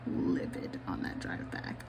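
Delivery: low, soft, seething near-whisper; quietly livid. Shown as a demonstration. Retelling the silent furious drive home, whispered low and slow. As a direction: hiss it under the breath. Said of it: "I was so angry".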